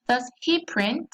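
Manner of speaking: "Does he print?" with the pitch climbing on print; rising question tune. Shown as a demonstration. Teacher showing students the rising tune of a question, slowly. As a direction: lift the end of "Does he print?" upward, slightly slow. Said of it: "la entonación va hacia arriba".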